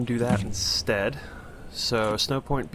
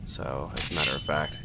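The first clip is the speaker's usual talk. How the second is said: usual speaking voice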